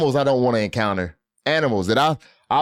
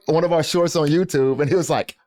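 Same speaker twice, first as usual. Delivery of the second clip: ordinary talk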